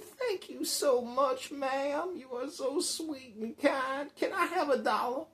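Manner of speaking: high, slow, drawn-out thanks; grateful beggar. Retold or imitated. Storyteller voices a man thanking his helper, gushing. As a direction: lay on sugary gratitude before asking.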